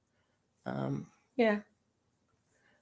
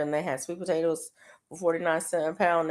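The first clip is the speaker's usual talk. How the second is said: ordinary talk, nothing marked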